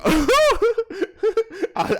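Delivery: shrieking laugh that breaks off; high cackling laugh. Real, not acted. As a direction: burst out laughing, squeaky and loud. Cracking up at own joke.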